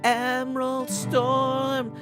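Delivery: normal talking voice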